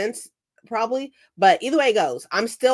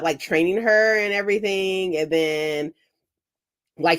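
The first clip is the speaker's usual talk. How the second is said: ordinary talk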